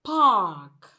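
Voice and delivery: "park" with a stressed open "ah"; loud, full vowel in "park"; long vowel. Shown as a demonstration. Teacher reads fifth example word, long stressed vowel. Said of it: "this particular sound, sound occurs in words like".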